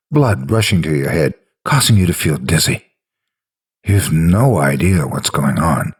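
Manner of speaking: usual speaking voice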